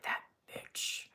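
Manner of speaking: whispered mock insult, under the breath; hushed aside imitating a viewer; imagined muttered jab.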